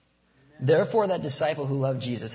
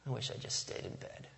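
tired muttered regret; 11 dB quieter than usual. Retold or imitated.

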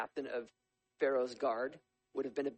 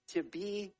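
normal talking voice

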